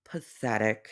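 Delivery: soft contemptuous murmur; scornful. Real, not acted. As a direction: sneer it quietly. Scornful verdict.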